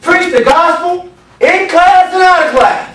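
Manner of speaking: high-pitched pulpit shout; forceful preaching. Real, not acted. Preacher applies the verse to all in the room, forceful preaching. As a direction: pitch it high and hold it there.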